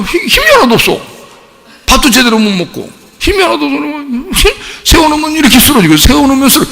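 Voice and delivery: high, strained voice of helplessness; high-pitched telling of a limp, sick child; distressed retelling. Retold or imitated. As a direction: pitch it high, like a worried parent pleading. Acting out his sick child's weakness.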